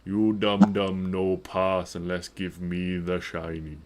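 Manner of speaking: slow, dim-witted troll grunt; troll barring the bridge, slow and dim; dumb troll voice. Acted. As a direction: talk like a slow brutish troll. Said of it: "points a finger at you all and says".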